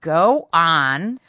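loud, insistent prompting; impatient command. A narrator's reading. Mistress urges her hesitating maid, loud and impatient. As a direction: urge her on forcefully.

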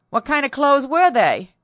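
high, loud interrogation; demanding question. A narrator's reading. Mistress interrogates her maid, loud and demanding. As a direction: press her hard for details.

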